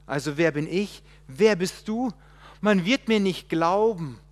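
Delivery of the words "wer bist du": normal talking voice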